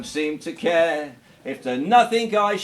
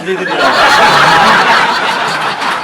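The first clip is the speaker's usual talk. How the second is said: drawn-out punchline; 12 dB louder, much slower than usual. Acted. Comedian milking the punchline for the audience. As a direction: stretch the punchline out slowly, laughing.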